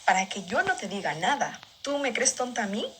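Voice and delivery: a hint of a mom menacing her daughter, low and quiet; angry mom. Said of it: "ella me decía".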